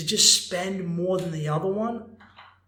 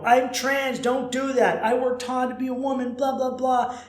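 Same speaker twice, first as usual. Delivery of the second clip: higher, mock offended protest; hammed-up huffy complaint, pitched up; mock outrage; 7 semitones higher, 3 dB louder than usual. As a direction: squeal it like a fake indignant streamer. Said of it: "she would flip out and go".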